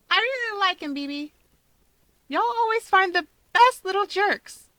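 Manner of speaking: put-on high bright girl voice; young woman voice. A narrator's reading. Rika's voice, high.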